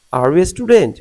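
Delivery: big high lift on "student"; questioning tune that climbs steeply into "student"; yes-no question intonation. Shown as a demonstration. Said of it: "আরো বাড়বে".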